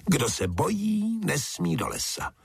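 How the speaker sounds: very high, piping character voice; squealed taunt. A narrator's reading. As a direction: squeak it out like a cheeky toadstool. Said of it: "zapištěla muchomůrka zelená".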